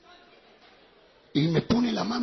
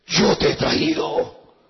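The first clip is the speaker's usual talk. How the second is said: prophetic rebuke building; 6 dB louder, slower than usual. Retold or imitated. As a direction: rise into a thundering prophecy. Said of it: "comenzó a hablar en lengua".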